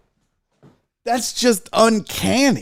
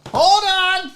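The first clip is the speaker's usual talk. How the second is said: calling out; 9 semitones higher than usual. Real, not acted. Unboxer telling viewers to wait, calling out. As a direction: call it out high and insistent. Repeats it as a louder call.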